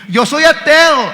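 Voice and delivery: loud, high, drawn-out boast; boastful atheist. Retold or imitated. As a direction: proclaim it slowly, puffed up with pride. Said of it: "algunos que se glorían, ... alardean".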